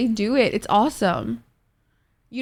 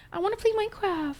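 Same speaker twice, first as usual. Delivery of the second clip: whiny plea; 11 semitones higher, 8 dB quieter than usual. Real, not acted. Begging chat for Minecraft.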